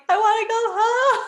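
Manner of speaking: high, loud mock wail; mock crying. Calling her mom, wailing like a crying child. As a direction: cry it out like a homesick child, hammed up. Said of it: "I'm like".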